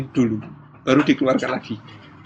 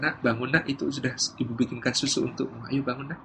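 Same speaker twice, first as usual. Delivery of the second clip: gentle mother; 2 semitones lower, 10 dB quieter than usual. Acted. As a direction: rouse a sleeping child very quietly. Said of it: "si ibu mengatakan". (ibu membangunkan dengan lembut)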